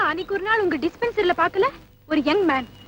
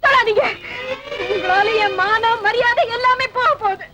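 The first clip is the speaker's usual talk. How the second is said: tearful outrage. Acted. Woman blaming the man for her lost honour, tearfully outraged. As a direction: push him away with a crying accusation. Upset woman rejects man's touch.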